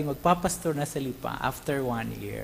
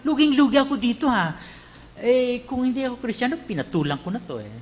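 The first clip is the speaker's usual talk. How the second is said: higher, louder whining complaint; 9 semitones higher, 5 dB louder than usual. Retold or imitated. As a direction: complain like a sore loser. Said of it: "Di ba dihado pag Kristiyano ka".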